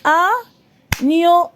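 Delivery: "Agneau" in two slow beats, A then gneau; teacher separating the two syllables of "Agneau". Shown as a demonstration. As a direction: count out "Agneau" with a clear gap between A and gneau. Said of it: "deux syllabes".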